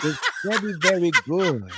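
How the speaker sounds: put-on comic Chinese-accented English; imitated Chinese accent, measured delivery; mock Chinese accent. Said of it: "that is a perfect, perfect accent".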